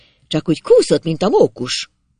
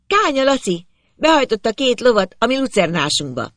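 high-voiced girl reporting urgently; mild squeal; 7 semitones higher than usual. A narrator's reading. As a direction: tell father in a high, urgent call. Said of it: "visította Zsuzsi".

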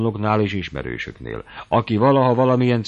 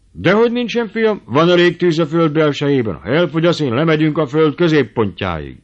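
much higher and louder, grand boast; boastful old uncle; 8 semitones higher, 7 dB louder than usual. Acted. Old uncle overruling his nephew, booming and grand. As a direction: sweep the objection aside triumphantly. Berci boasts about earth's fire.

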